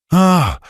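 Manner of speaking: high, loud pained scream. A narrator's reading. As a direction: cry out as it burns. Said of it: "he screamed".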